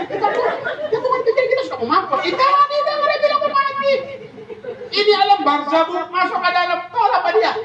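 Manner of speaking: squealing dead man; high comic panic. Acted. The dead man squeals in panic in the grave. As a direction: ham up the dead man's fright.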